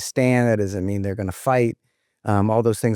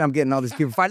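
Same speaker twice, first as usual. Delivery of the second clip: usual speaking voice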